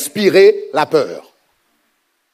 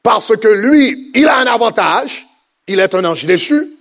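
higher, rising preacher's cry over music; full-force preaching; 4 semitones higher than usual. Acted. Preacher rousing the church against fear, full preaching force. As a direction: build the congregation up, voice climbing.

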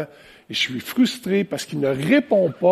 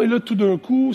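ordinary talk, nothing marked

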